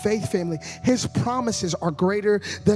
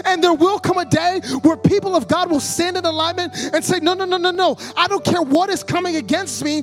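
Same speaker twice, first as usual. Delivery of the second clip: loud, raised, slowed declaration; full-force preaching; 6 semitones higher, 5 dB louder than usual. Acted. Preacher leading the people to declare God's promises. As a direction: proclaim it with defiant faith. Sermon climax, defiant declaration.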